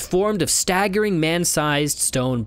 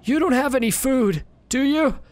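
sad pleading; 10 semitones higher than usual. Acted. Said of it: "he asks sadly".

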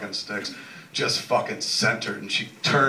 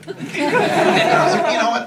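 laughing; 9 dB louder than usual. Real, not acted. Cracking up mid story.

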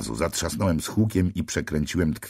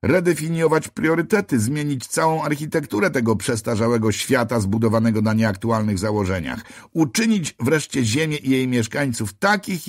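high, loud lofty proclamation; grandiose angel; 9 semitones higher, 4 dB louder than usual. A narrator's reading. Fallen angel lecturing the narrator, high lofty proclamation. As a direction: preach the new world order from on high.

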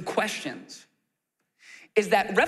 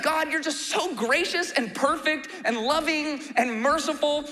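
mock complaining Jonah; 8 semitones higher than usual. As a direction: drag out the compliments like a grievance. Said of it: "Jonah's like".

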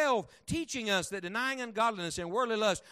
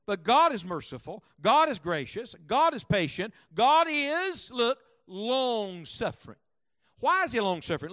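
rising loud preaching, each "God" punched; preacher swelling into a loud climax; 2 semitones higher, 6 dB louder than usual. Acted. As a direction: build it up like a revival sermon. Preacher building to full force.

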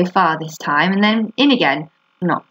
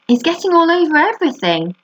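lightly acted high shout; 10 semitones higher than usual. A narrator's reading. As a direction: call it out high, like an indignant tattletale. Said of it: "shouted Fern".

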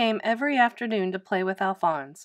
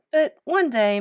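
usual speaking voice

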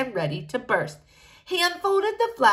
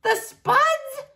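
much higher squeal of disbelief; shocked king. A narrator's reading. King horrified by the Spuds, squealing. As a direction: squeak it in horror.

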